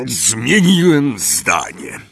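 slow, weighty gruff voice; pompous uncle. A narrator's reading. Uncle solemnly announcing to Harry. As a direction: announce it with self-importance.

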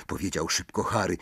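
usual speaking voice

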